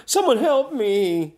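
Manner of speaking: high, slow, hammed-up squeal for help; panicked cry for help. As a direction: play the wimpy steward begging for rescue. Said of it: "guy goes".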